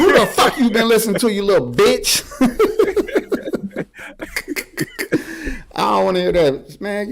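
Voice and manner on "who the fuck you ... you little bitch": half-laughing, higher scolding; laughing mock scold. Father teasing daughter, laughing scold. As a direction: scold her through laughter.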